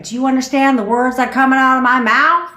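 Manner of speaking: hammed-up furious quote; mock angry demand. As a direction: bark the movie line in jest. Quoting a famous movie line.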